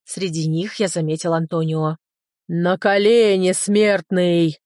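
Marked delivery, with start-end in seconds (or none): 2.5-4.6 slow, booming demon command; booming monster roar. Acted. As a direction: roar it like a struck gong. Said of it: "Гулко взревело это чудовище". (гулкий рёв чудовища)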